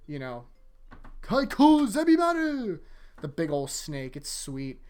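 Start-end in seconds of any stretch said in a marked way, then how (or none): 1.2-2.8 much higher, louder, slow anime battle cry; attack-name yell. Retold or imitated. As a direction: bellow it like unleashing the snake. Calling out the Bankai name.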